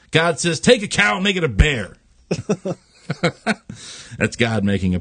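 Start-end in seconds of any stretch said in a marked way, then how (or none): 0.6-2.0 fast, higher, spelled out; exasperated God. Acted. God spelling it out to the angel, fast and exasperated.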